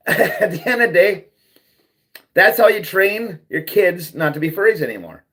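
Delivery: usual speaking voice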